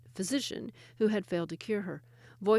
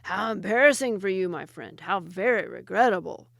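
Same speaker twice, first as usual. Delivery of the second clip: much louder, lion-gruff jinni; 6 dB louder than usual. Acted. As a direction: growl it mockingly like a lion. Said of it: "in a voice as gruff as any lion".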